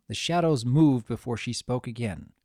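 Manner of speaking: ordinary delivery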